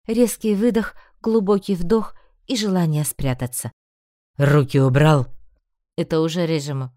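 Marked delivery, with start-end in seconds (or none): 4.4-5.3 loud low angry order; sharp command. A narrator's reading. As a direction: bark it as a cold order. Lord orders Bran away.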